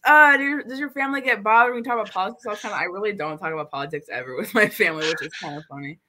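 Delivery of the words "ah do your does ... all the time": high, loud, fast mock-curious question; nosy relatives asking. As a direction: imitate the people who always ask, hammed-up. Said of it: "People are always like".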